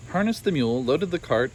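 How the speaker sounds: ordinary delivery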